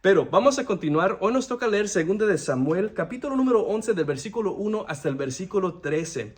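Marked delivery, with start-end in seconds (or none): none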